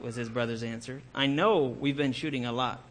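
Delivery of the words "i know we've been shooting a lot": normal talking voice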